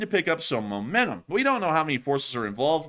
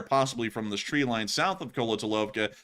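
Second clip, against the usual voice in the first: ordinary talk, nothing marked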